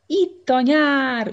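high, slow, loud bellow; roaring shout. Acted. Monkey chief announcing summer to the crowd, roaring. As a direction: roar it to the whole crowd. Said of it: "üvöltötte immár a majomfőnök".

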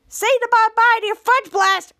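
high, loud mock threat; mad threat. Charlotte grabs Henry's fudge blast, mad and threatening. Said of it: "she was so mad".

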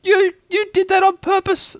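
very high, stammered indignant squeal; squeaky spluttered accusation; spluttering. A narrator's reading. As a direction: splutter it out with a mouthful of soap. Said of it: "spluttered Piglet".

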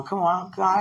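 normal talking voice

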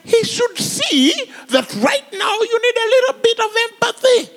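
hammed-up whining about no empathy; mock indignant complaint. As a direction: play the offended disciple. Mocking complaint about Jesus.

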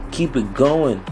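normal talking voice

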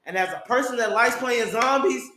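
normal talking voice

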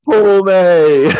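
high, loud fond cry; loud affectionate goodbye; fond exclamation. Real, not acted. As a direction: call out warmly to a friend.

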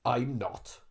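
low, soft Welsh voice; Welsh accent. Acted. Welsh client denying he is dead, Welsh accent. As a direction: answer softly in a Welsh accent. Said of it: "I find that [name] doing Welsh".

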